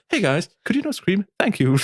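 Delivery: high, sugary passive-aggressive request; Fluff voice. Retold or imitated. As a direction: put on a sweet YouTuber voice to shush the band. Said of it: "es ist in der Fluff-Stimme gewesen".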